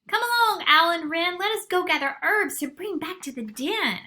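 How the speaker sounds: high, loud calling raven; rally the birds to go. Acted. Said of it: "Raven says".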